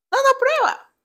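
squeaky high imitation urging a taste; mock impression of boyfriend. She imitates him offering milkshake, exaggerated high voice. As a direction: pitch it far up, insistent. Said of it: "Pero yo no hablo así".

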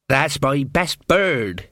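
slow, proud character voice. A narrator's reading. Bird keeper to a visiting elf, proudly. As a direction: show off a prized bird, slowly. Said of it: "he said proudly".

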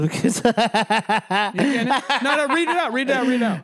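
laughing while urging him on. Real, not acted. Teasing his friend to read it aloud, laughing. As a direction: push him to read it, cracking up.

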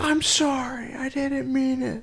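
high, slow whimpering apology; mock crying. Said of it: "she is, like, crying".

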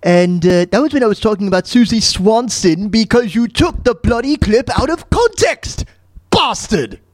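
high-pitched, indignant Stewie impression. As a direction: put on a high, accented cartoon voice, scolding. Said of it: "Well, Stewie".

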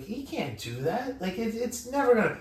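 dad's disbelief, pitch jumping up; mock outraged dad, climbing high; imitating dad. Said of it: "He was like".

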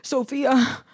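hammed-up anxious gasping out of words; imitating nervous friend. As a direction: act out a friend freaking out. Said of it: "so nervous".

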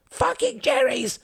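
high, drawn-out battle scream. Acted. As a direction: scream it wildly at the enemy. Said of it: "screamed Harold".

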